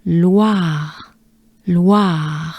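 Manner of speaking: Loire as "lwa" plus breathed r; drawn-out Loire on a full breath; French Loire. Shown as a demonstration. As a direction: model Loire slowly for repetition. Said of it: "Let's take a good breath together".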